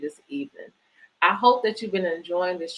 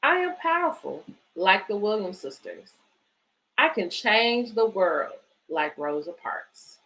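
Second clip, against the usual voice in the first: put-on reading to children. Reads picture book aloud.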